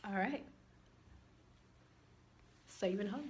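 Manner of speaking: quiet, flat sarcasm; deadpan. Retold or imitated. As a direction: mutter it softly, unimpressed. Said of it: "And I was like".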